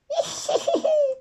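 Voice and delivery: very high mocking cackle; witchy cackle. The fairy cackling at Lola after knotting her trunk.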